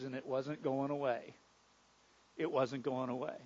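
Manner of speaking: hopeless, subdued admission; quiet resignation. Acted. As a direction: drop right down, low and hushed. Despairing at incurable disease.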